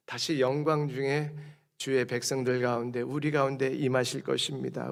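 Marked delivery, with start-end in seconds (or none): none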